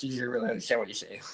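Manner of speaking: imitating a thick English accent, mumbled fast; rushed, low English mumble from back of throat; Harry Kane. Co-host imitating a footballer's hard-to-follow speech, thick English accent. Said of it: "He's got this really thick English accent".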